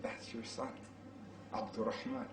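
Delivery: soft whispered revelation; hushed reveal. Acted. Wife revealing to her husband who their son is, whispered. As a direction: let it land in a whisper.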